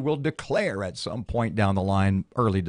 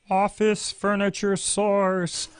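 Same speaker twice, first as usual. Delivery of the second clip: slow, higher, trance-like drawl; hypnotic voice; 8 semitones higher, much slower than usual. Acted. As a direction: lull them into a trance. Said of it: "That's my hypnotic voice".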